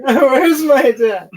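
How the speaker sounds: high, loud, drawn-out wail; hammed-up cry for a parent; mock wail. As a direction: wail it like a lost child. Character walks away wailing for dad.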